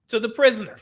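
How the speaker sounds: ordinary talk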